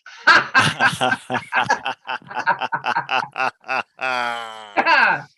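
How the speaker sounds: laughter bursting out after holding it in; released laugh in laughter yoga; open mouth laughter. Shown as a demonstration. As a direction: let the held laugh spill out. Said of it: "open your mouth and let that out".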